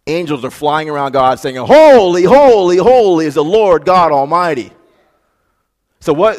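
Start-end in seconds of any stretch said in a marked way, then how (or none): 1.6-4.7 loud, higher ringing cry; angelic proclamation. Retold or imitated. Angels crying praise around God's throne, loud. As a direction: call it out like the angels.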